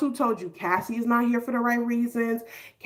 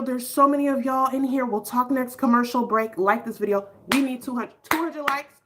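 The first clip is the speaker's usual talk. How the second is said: usual speaking voice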